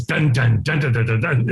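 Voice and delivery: low, rhythmic drumbeat noises; imitated drums. Describing African drummers outside, imitated drumbeat. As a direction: chant out an imitation drum pattern. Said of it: "it's the ambiance of the drums".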